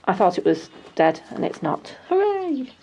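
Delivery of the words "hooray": high delighted cheer; excited cheer. Real, not acted. Delight at dahlia shoots.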